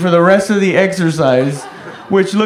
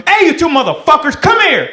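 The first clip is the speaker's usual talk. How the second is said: high, loud barked order; angry platoon sergeant; 10 semitones higher, 4 dB louder than usual. Retold or imitated. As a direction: bellow it like a furious sergeant. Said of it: "he got really angry".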